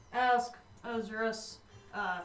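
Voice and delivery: ordinary talk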